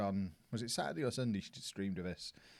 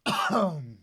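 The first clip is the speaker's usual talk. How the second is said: loud hacking cough; harsh cough; 8 semitones higher, 8 dB louder than usual. Real, not acted. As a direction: cough out the stuck fly. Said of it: "Something hit the back of my throat".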